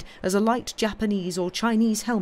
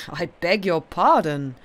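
lightly voiced affronted colonel; a touch of incredulous colonel; taken-aback colonel; 4 dB louder than usual. A narrator's reading.

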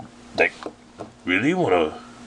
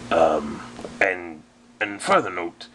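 ordinary talk

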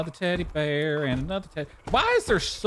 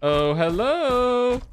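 surprised hello; 7 semitones higher, 4 dB louder, much slower than usual. Real, not acted. Spotting someone in the dark.

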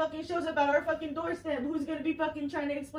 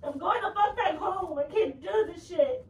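high, loud, tearful outburst; sobbing angry words, slowing at the end; crying; 8 semitones higher, 8 dB louder than usual. Real, not acted. Argument, she breaks down crying.

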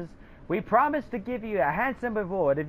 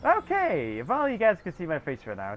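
ordinary talk

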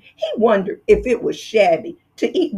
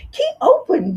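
high, sharp yell; yelled order; 10 semitones higher than usual. Acted. Dentist yelling to the fox to keep open, high. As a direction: shout the order up at him. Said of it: "yelled Doctor DeSoto".

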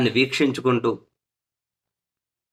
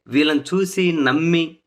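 ordinary delivery

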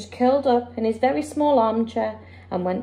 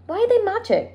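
Jack's voice; 10 semitones higher, much faster than usual. A narrator's reading. Jack asks the stranger.

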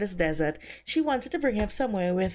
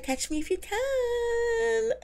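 playful flirt voice; 12 semitones higher, slower than usual. Acted. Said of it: "She's like".

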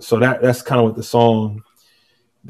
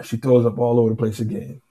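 ordinary talk, nothing marked

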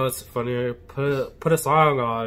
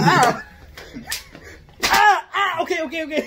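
high panicked yelling; panicked screams; 7 dB louder than usual. Real, not acted. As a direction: scream and surrender. Being hit, screaming.

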